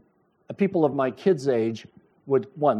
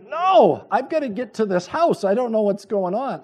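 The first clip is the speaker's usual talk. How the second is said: urgent inner voice; 6 semitones higher, 4 dB louder than usual. Retold or imitated. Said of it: "my brain's going".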